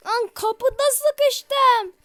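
stuck in the door, yelling; frustrated yell. Real, not acted. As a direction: drag it out loud in frustration. Stuck in door while chased.

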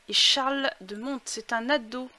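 ordinary delivery

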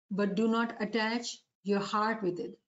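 usual speaking voice

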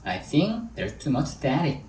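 slow, with pauses between chunks; deliberate, phrase-by-phrase English demonstration; slow drill model. Shown as a demonstration. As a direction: model it slowly for learners to repeat. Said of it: "천천히 해볼게요".

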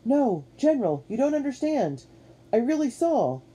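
high-pitched insistent protest; pleading protest. A narrator's reading. Captain protesting to doubting Washington, pleading. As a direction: plead to be believed.

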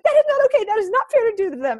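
high, loud indignant protest; ranting mom. Retold or imitated. Mom rants about her children's food, loud and high. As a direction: rant hard for your kids. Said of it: "I came home on a rant".

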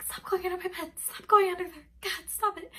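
high, loud frantic yell; panicked yell at the cat under the bed. Retold or imitated. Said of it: "yelling at my cat".

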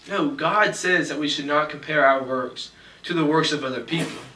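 usual speaking voice

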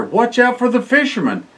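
ordinary delivery

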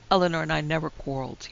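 ordinary delivery, nothing marked

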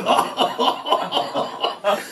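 high scornful laugh; mocking laughter. A narrator's reading. Guests mocking the cheese explanation with laughter. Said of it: "Hohngelächter".

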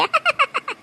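short, high-pitched mocking laugh; high cackle. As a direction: burst into a teasing cackle. Laughs after teasing the aunt.

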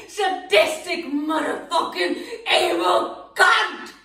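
sobbing, furious cursing; tearful fury at Serena; crying rage. Acted. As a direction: spit the insults through tears.

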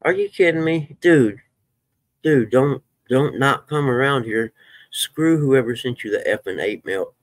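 real protective anger rising fast; fired up. Real, not acted. Streamer defends a viewer from hate mail, fired up and loud. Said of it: "I get so fired up".

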